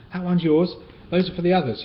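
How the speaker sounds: high, loud breathless rush; puffing, in a hurry; panting. Acted. Kipper handing Tiger the invitation, panting. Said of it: "he panted".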